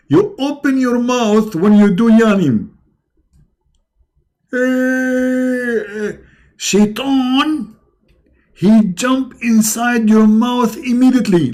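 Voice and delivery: mock preacher. Mocking preacher on Shaitan and yawning.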